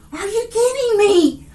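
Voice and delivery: can't believe what she's seeing; excited disbelief. Real, not acted. Reader stunned by what Spirit shows, excited disbelief. Reacting to a card reveal.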